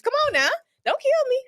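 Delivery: high pleading plea; mock pleading. Begging aliens not to kill her, high mock plea. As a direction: beg for your life, half joking.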